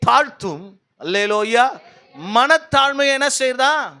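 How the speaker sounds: slow, emphatic verse reading with drawn-out words; declaimed scripture preaching. Real, not acted. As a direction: proclaim each phrase for the congregation to follow.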